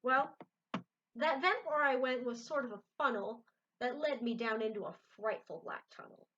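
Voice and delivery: lively read-aloud voice for a picture book; story narration. A narrator's reading. Reader to viewers, reading a Seuss book aloud. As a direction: tell the rhyme with bounce.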